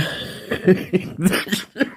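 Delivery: a real cough breaking out of a laugh. Real, not acted. Vlogger with a sore throat coughs mid-laugh at her viewers. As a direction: cough through a stifled giggle. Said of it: "J'ai envie de rigoler et puis, euh, ça me fait tousser".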